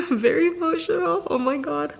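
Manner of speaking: overwhelmed right after finishing the book; laughing through tears. Real, not acted. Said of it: "I've been crying".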